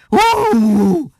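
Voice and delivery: high, drawn-out howl of protest; long high yowl; dog's howl. A narrator's reading. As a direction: howl out like a dog hit on the nose. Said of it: "üvöltött fel ellen Mérges".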